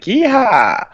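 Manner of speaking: slow, high mock-Southern yeehaw; hammed-up cowboy holler, drawn out. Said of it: "I'm just being very stereotyped".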